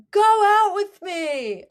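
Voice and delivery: high, loud, long-drawn mock plea; yelling across a field. Host gleefully repeats Gibsie's call to Claire, yelling. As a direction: wail it out slowly like a call over distance. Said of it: "yelling across the field to her".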